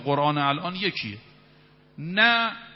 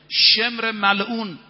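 contemptuous cry; 5 semitones higher, 5 dB louder, much slower than usual. Acted. Preacher names Shemr with contempt.

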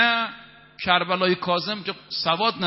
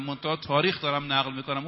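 normal talking voice

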